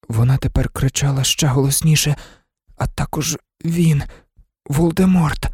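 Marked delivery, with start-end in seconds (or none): none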